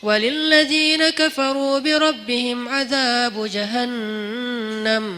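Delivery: slow, chanted tilawah; Quran recitation. Acted. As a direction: recite it slowly like at a mosque. Reciting Surah Al-Mulk. (tilawah pelan dan berirama)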